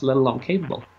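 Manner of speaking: ordinary talk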